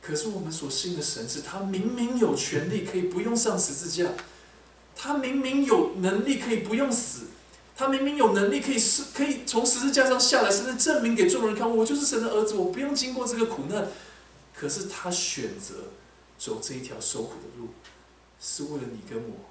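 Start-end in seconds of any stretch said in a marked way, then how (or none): 4.9-13.9 rising to a forceful climax; preaching at full force. Real, not acted. (大声激昂地讲道)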